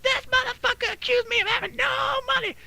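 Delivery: high-pitched caricature of public outrage; imitated screaming. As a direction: imitate her shrieking accusation. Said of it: "starts screaming at me in Kroger's".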